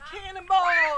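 loud jump call; cannonball yell. Real, not acted. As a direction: yell it mid-leap. Second jump countdown.

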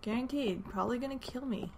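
normal talking voice

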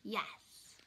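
usual speaking voice

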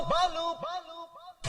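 the same long Balu call dying away to nothing; man calling for Balu as his voice fades out; fading call. Acted.

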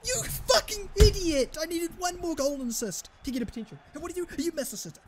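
screamed insult, then high-pitched ranting; real rage at a missed chance; furious outburst. Real, not acted. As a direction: explode high and loud, then complain rapidly.

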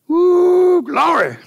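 high, loud cry of praise; joyful shout. Real, not acted. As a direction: burst out in joy. Preacher's joyful exclamation.

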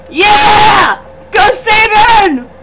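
high, loud drawn-out cheer; jubilant, stretched-out shout; excited cheering. Real, not acted. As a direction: cheer your fighter on at full voice. Cheering a battle win.

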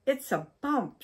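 high, loud alarmed cry; frightened cry. A narrator's reading. Wife in bed hears a bump, alarmed cry. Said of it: "she cried".

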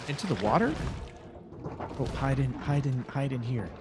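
panicked. Real, not acted. Fleeing monster in game.

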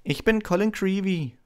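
put-on high, eager boy introducing himself; halting, breathless high voice; breathless Colin. A narrator's reading. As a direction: stammer out a shy, high-pitched greeting. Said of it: "sagte er atemlos".

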